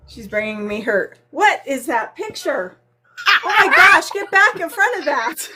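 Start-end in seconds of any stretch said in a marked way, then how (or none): 3.3-5.5 high, loud startled yelp. Real, not acted. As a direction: cry out as the picture jumps at you. Startled by picture, yelling.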